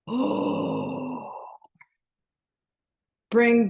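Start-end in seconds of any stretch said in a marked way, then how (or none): 0.1-1.3 long audible sigh out; big releasing sigh; dramatic sigh. Shown as a demonstration. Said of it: "Big sigh".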